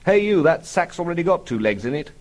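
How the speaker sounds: acted brusque call. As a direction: call out to someone busy in the street. Father calling out to people in street.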